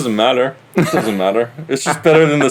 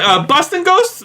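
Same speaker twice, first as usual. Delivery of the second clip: mock disbelief; slower than usual. Mock incredulous Boston guy.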